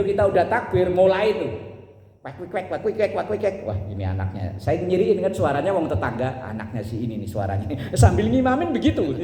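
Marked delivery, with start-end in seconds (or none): 2.3-3.6 quick, quiet quacking babble; soft mock jabber; mimicked children's chatter. Imitates children chattering during prayer. 6.4-7.7 low, soft aside to himself; note the culprit under your breath; quietly working out whose kid it is; private thought during prayer. Acted. Imam's inner muttering during prayer.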